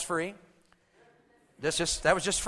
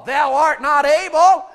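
louder, higher, slow and drawn out; Saul's pronouncement; 6 semitones higher, 11 dB louder, slower than usual. Shown as a demonstration. Congregation coached to play Saul to David, slow loud pronouncement. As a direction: proclaim it slowly like a king. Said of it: "Say it like you're Saul".

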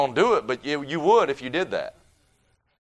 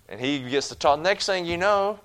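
ordinary talk, nothing marked

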